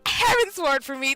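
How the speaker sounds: ordinary talk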